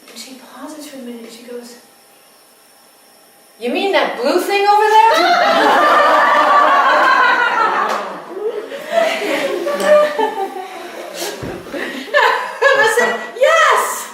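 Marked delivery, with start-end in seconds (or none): 3.6-5.1 high and loud, laughing as she asks; laughing question. Retold or imitated. Mother spots the blue light and asks the midwife, laughing. 5.2-12.7 loud, high rising peal of laughter; big laugh. Real, not acted. Storyteller laughs hard remembering the moment, big laugh. 12.8-14.1 high, loud yes through laughter; laughing yes. Real, not acted. Midwife confirms the light to the mother, laughing yes.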